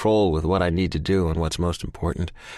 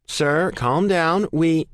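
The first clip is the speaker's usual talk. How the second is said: operator voice; 10 semitones higher, 3 dB louder, much slower than usual. Acted. 911 operator voice.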